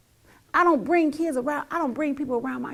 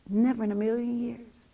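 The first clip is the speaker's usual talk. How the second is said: usual speaking voice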